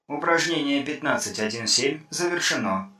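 flat metallic voice from a speaker. Acted. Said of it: "раздался металлический голос из динамика".

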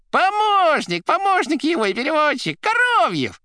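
very high, loud squawking voice; crackling chatter. A narrator's reading. Assistant introducing himself by phone, crackling and high. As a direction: rattle off the introduction at a squeak. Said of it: "трещала трубка".

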